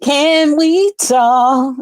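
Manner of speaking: slow, high, drawn-out "Can we talk"; quoting him. Retold or imitated. Quoting the performer's request, slow and high. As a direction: stretch each word out. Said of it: "quoting performer's request".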